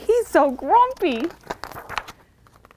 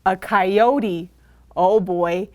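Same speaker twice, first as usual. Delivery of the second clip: ordinary delivery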